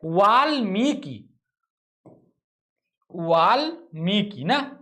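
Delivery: वाल्मीकि very slow, clearly syllable by syllable; वाल्मीकि, slow careful teacher demo; correct spelling shown. Shown as a demonstration. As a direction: spell out वाल्मीकि slowly for the class. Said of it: "कैसे लिखा जाता है".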